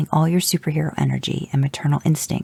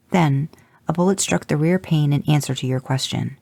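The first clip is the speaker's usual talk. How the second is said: usual speaking voice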